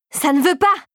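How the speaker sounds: high, frustrated outburst; angry yell. A narrator's reading. Regent fails to turn the key, yelling in anger. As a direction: cry out in frustration, pitch jumping up. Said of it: "hurla-t-elle de colère".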